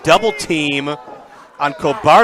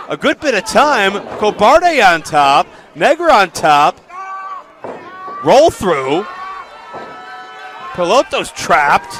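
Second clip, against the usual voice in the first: raised, loud live play-by-play; lucha libre announcer; 4 semitones higher, 3 dB louder than usual. Real, not acted. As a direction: call the action as it unfolds.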